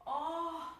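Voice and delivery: Ó held long and high; stressed syllable drawn out. Shown as a demonstration. Teacher hunting the tonic syllable, Ó stretched. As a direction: call out a high open Ó. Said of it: "Vamos primeiro encontrar essa sílaba tônica".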